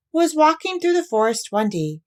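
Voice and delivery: ordinary delivery, nothing marked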